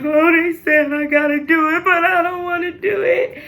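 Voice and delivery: high, whiny mock crying; retold crying. Telling mama about the C-section, mock crying. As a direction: wail it in an imitated tearful protest.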